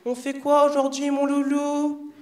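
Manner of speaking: voix aiguë et traînante, pleine de douceur; high sweet hairdresser. Retold or imitated. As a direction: minaude comme une coiffeuse mielleuse. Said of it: "les coiffeuses, elles te posent". (coiffeuse qui minaude d'une voix aiguë et lente)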